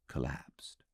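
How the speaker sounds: usual speaking voice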